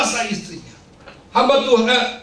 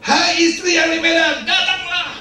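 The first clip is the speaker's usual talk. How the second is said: hammed-up prayerful call, pitched up; 7 semitones higher than usual. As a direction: summon someone far away in a put-on call. Summoning distant wife within a prayer.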